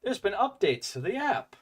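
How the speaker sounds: chirpy app notification voice. Retold or imitated. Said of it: "had it pop up on my screen saying".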